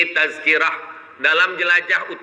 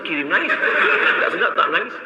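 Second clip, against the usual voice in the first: chuckling through the answer; amused, laughing reply; a congregant laughs as he explains why he cried; 4 semitones higher, faster than usual. Retold or imitated. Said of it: "mereka pun saling bertanya".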